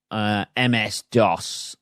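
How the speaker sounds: ordinary talk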